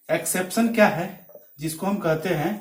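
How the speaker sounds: ordinary delivery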